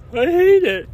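fighting back tears as he talks; crying. Real, not acted. Man alone on camera hating his own mind, crying. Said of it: "so nobody knows I'm crying".

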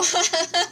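short amused laugh. Real, not acted. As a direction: laugh at the joke. Laughs at journal name.

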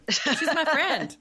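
high, slow put-on voice mocking him; playfully mocking his excuse, slow and high; mock Jay. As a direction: drag it out in a silly high mock voice.

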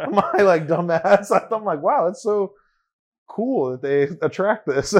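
high, loud, laughing retelling. Real, not acted. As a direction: laugh while telling it. Laughing retelling own reaction.

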